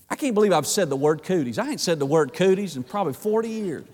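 usual speaking voice

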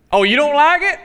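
high, loud mock-incredulous mother; imitating scolding mom. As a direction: pitch it up like a challenging mom. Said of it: "what my mom used to say".

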